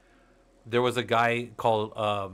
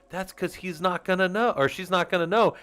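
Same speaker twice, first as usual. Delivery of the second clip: Wayne Brady quote; 8 semitones higher, 7 dB louder than usual. Retold or imitated. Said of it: "And he's like".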